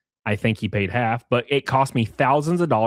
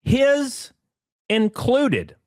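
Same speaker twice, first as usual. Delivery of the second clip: pointed jab; 10 semitones higher, much slower than usual. Acted. Punchline jab.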